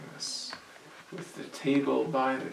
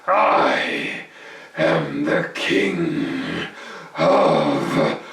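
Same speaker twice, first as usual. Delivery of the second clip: much lower, loud and very slow; menacing goblin king; 10 semitones lower, 11 dB louder, much slower than usual. Acted. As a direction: drag out each word to loom over him.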